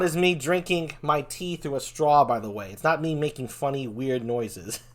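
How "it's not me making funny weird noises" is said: ordinary talk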